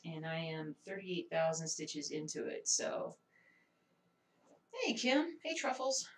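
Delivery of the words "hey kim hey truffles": usual speaking voice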